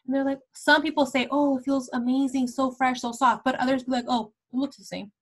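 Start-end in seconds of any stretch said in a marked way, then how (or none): none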